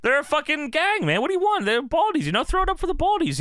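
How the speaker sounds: big up the gang like a fan; mock gang hype.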